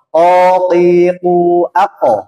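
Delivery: "Okiku ako" drawn out slowly; slow held drill. Shown as a demonstration. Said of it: "Jangan kekiku". ("Okiku ako" pelan, k ditahan)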